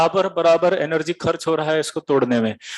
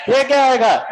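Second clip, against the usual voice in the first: insistent; 7 semitones higher, 4 dB louder than usual. Shown as a demonstration. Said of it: "teacher presses class".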